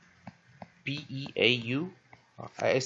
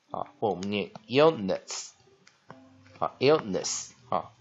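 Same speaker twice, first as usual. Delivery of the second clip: normal talking voice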